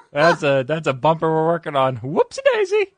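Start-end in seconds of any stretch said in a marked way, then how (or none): 2.0-2.9 squeaky high whoopsie; high silly voice. Pretend.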